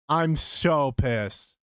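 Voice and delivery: slow, stretched "I'm so pissed"; drawn-out annoyance. Real, not acted. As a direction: drag each word out in mock frustration.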